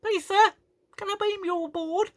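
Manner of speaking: very high, squeaky child impression; mock a little kid asking politely; twelve-year-old crewman. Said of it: "transporter chief looks about twelve".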